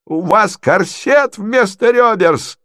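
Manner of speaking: high, slow, gleefully nasty addition; jeering provincial lady. Acted. Natalya adds her insult, high and drawn out. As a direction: pile on with a swooping high jeer.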